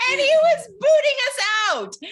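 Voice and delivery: loud, high-pitched storytelling outburst; exclaiming. Real, not acted. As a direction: squeal the punchline over the laughter. Outraged punchline of her story.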